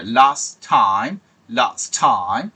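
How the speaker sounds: লাস্ট টাইম with one t; linked last time. Shown as a demonstration. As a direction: say লাস্ট টাইম slowly, t dropped. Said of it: "এই sound আসবে".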